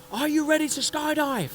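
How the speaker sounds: high shout over the engines; instructor shouting. Retold or imitated. Instructor yells at the frozen jumper, high shout. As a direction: call it out over the roar. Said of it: "he goes".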